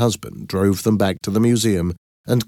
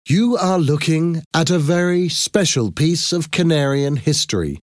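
higher-pitched address to a crowd; exhibition presenter; 8 semitones higher than usual. Acted. Thomas explains the exhibit to the crowd, formal presenting. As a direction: present it proudly to an audience, pitched up.

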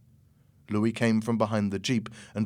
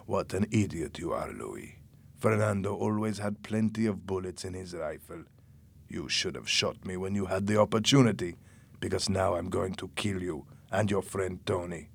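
low, subdued villain voice; menacing Jose, cold threat; 3 semitones lower, 5 dB quieter than usual. A narrator's reading. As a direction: threaten quietly, pitched down.

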